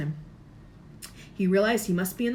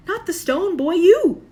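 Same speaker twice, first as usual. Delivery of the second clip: high loud stress on you; emphatic correction; 11 semitones higher, 11 dB louder than usual. A narrator's reading. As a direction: insist it was him that mattered. Dumbledore correcting Harry.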